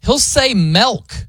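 high, slow "milk" with an odd vowel; Ben's milk. Retold or imitated. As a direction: stretch out "milk" the way Ben does. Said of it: "I'll hear him".

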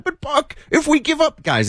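very high-pitched whiny-listener imitation; mock upset caller. Host imitating a listener calling to complain, mock upset. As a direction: mimic an angry caller in a squeaky high voice. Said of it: "don't call me and get mad".

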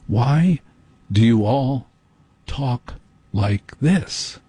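very slow, word by word, with pauses; slow public-radio delivery. Acted. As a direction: mimic a condescending radio presenter, painfully slow. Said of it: "spoken to clearly and slowly".

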